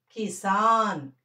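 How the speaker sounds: slow కిసాన్ with a long saan; teacher's drawn-out model of కిసాన్; pronunciation demo. Shown as a demonstration. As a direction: stretch సా in కిసాన్, end on bare n. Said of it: "కిసాన్ అని పలకాలి".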